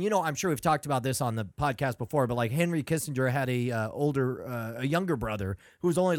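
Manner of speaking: ordinary talk, nothing marked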